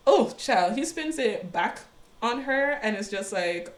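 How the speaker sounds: ordinary delivery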